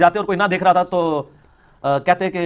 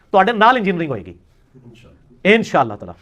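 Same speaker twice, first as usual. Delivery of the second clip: normal talking voice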